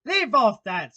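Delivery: loud, high, irritated yell; screamed command. Acted. Queen stopping the gardeners' bowing, screaming. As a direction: yell at them to stop. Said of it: "screamed the Queen".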